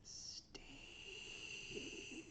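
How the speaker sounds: whispered name; hushed call. Real, not acted. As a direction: breathe the name unvoiced. Calls name out of quiet.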